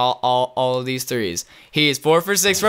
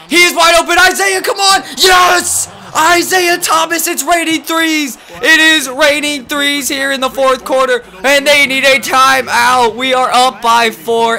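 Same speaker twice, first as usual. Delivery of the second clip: much higher, loud commentary yell; excited announcer shout; 10 semitones higher, 6 dB louder than usual. Real, not acted. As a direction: scream the name like a buzzer call. Yelling live play-by-play.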